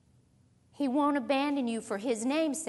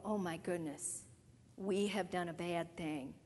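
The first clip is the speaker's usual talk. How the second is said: low, much softer voice of dawning guilt; hushed dismay; 6 semitones lower, 10 dB quieter than usual. Acted. Israelites realize their sin after seeing rain, hushed dismay. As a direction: drop low and quiet, as if realizing a wrong.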